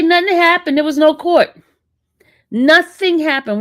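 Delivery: NOTHING happened, pushed out hard; emphatic insistence. Real, not acted. Insisting to viewers no court.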